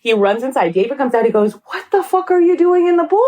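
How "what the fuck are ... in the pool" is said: high, laughing baffled question; baffled husband. Retold or imitated. Husband to wife soaked in the pool, mock baffled. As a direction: react like a confused husband.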